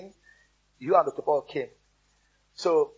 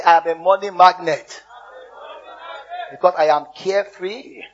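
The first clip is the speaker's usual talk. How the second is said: loud declaration; 7 dB louder than usual. Shown as a demonstration. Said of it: "Say it again".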